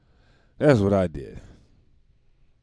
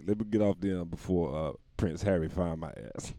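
normal talking voice

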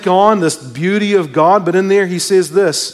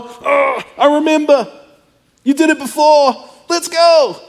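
higher, brightening "Oh, I remember" into "Let's go"; eager realization; 6 semitones higher than usual. Acted. Preacher voicing a believer who remembers God's help, excited. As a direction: spark of recognition rising to a rallying cry.

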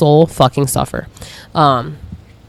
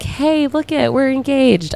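playful, higher, a bit softer; mock announcing; 9 semitones higher, 5 dB quieter, slower than usual. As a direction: show off the news lightly. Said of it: "be like".

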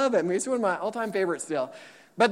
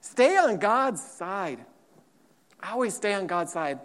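ordinary talk, nothing marked

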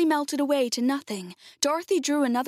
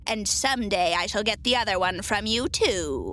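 mocking witch. A narrator's reading. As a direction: threaten tauntingly as the wicked witch. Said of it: "laughing at her".

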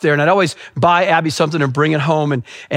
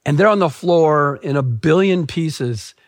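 normal talking voice

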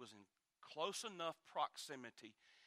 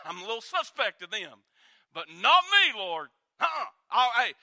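loud, high, boastful vow; indignant boast; 8 semitones higher, 20 dB louder than usual. Retold or imitated. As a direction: swear loyalty louder and louder. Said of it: "Simon Peter got indignant with Jesus".

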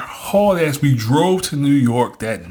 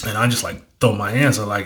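ordinary talk, nothing marked

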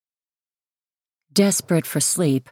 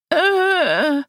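high whining wail; child wail; 12 semitones higher than usual. A narrator's reading. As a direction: wail like an upset kid. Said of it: "Devon wails".

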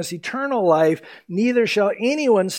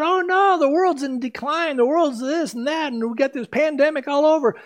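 high, fast, panicky worrying; mock panic; 9 semitones higher than usual. Preacher mimicking panicked people: higher, fast and fretful. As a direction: play the fretful crowd in a flap. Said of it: "a lot of people are freaking out".

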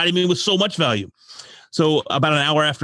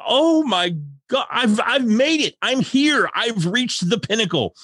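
higher, mock-ecstatic gushing; thrilled disbelief; 9 semitones higher, slower than usual. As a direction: act like you've finally made it. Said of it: "And I'm like".

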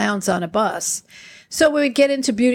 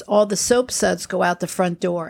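ordinary talk

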